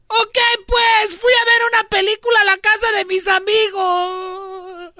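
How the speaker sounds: high put-on boy voice; kid voice. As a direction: squeak out the excuse like a kid. Son lies to father.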